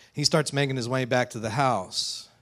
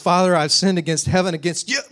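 raised, louder son reciting his apology; rehearsed confession; 7 semitones higher, 8 dB louder than usual. Acted. Prodigal son confessing to his father, rehearsed. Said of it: "say the lines that he's rehearsed".

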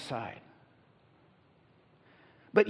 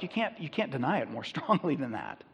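ordinary talk, nothing marked

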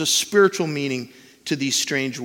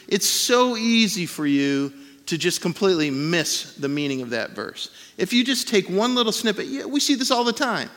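ordinary delivery

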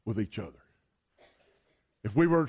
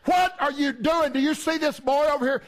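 indignant wife; 6 semitones higher than usual. Acted. Wife to husband reading the paper, acted indignation. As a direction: act out a wife taking her husband to task.

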